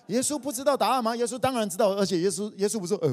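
loud, high, fired-up sermon; preacher hammering his point loudly; preaching at full force. Acted. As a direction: drive it home to the congregation, full voice. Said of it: "preaching at full force".

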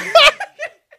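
laut losprusten; high, loud burst of laughter. Real, not acted. Big laugh after joke.

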